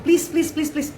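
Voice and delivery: pleading. Real, not acted. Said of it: "para Bunda tolong".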